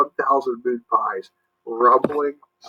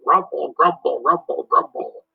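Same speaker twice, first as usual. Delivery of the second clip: monster rumble; 3 semitones higher than usual. Acted.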